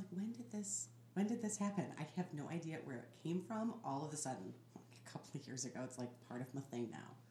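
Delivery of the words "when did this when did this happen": higher-pitched puzzled self-quote; retold bewilderment. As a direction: act out catching yourself, pitched up. Acts out catching herself.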